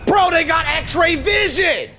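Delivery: high, slow outraged yell; disbelieving shout. Real, not acted. Spotted despite smoke.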